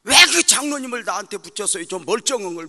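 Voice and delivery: loud, high whiny protest to God; loud complaint. Retold or imitated. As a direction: cry out against the elder. Complaining to God about elder. (하나님께 떼쓰듯 외치는 불평)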